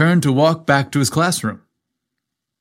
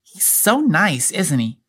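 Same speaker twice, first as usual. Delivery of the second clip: put-on little girl; 5 semitones higher than usual. A narrator's reading. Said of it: "when Emily proclaimed".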